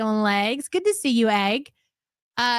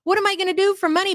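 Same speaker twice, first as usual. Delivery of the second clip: mock pleading husband; 7 semitones higher than usual. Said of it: "And normally it's the".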